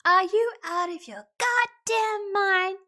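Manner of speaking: high, loud, drawn-out fury; outraged. Acted. Player confronting Nate in disbelief, high loud outrage. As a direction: blow up in disbelief, leaning on GODDAMN. Retake of acted outburst at Nate.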